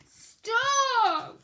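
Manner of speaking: loud, high growled stop. Acted. Bear cutting off Mouse's reading, angry growl. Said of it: "growled Bear".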